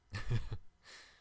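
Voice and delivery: a short breathy chuckle at the listener. Acted. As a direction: laugh lightly, charmed by their wonder. Amused at human staring out window.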